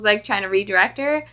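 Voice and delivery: ordinary delivery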